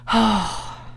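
long breathy exhale; sigh of relief. A narrator's reading. Owner sees his pig is fine, relieved sigh. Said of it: "He sighed with relief".

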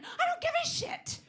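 high, loud blowup at the end; exploding shout. Retold or imitated. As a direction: snap it out at the top of the voice. Rant climax, far voice.

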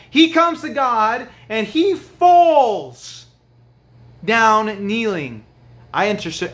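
high, loud preaching, slowing down; preaching at full force. Acted. Preacher telling the leper story, forceful and slow. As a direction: drive the story home to the congregation.

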